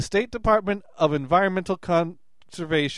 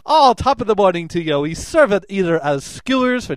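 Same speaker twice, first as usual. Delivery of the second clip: loud, hammed-up stage Irish; bad Irish accent, played for laughs; mock Irish accent; 4 semitones higher, 6 dB louder than usual. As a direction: slip into a cartoon Irish accent. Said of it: "your Irish doesn't sound Irish".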